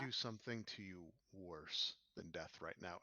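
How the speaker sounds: low, menacing; quiet threat. Acted. NPC threat.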